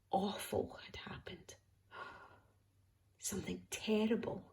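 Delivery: hushed, horrified whisper. A narrator's reading. Narrator realising the chocolate is gone, a soft whisper. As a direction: breathe it like a dreadful secret.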